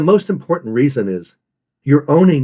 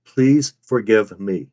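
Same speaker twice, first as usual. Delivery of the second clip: PLEASE, FORGIVE, ME each stressed slowly and clearly; slow, remorseful "Please forgive me"; stressed, true remorse; 2 semitones lower, much slower than usual. Shown as a demonstration. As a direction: give "Please", "forgive" and "me" separate weight. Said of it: "stress each of the following three words".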